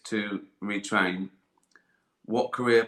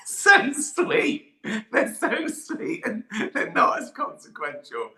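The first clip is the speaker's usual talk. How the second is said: high, squeaky laughing speech; words riding on real laughter; 12 semitones higher, 5 dB louder, slower than usual. Real, not acted. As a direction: crack up while judging the options. Laughing at the silly question options.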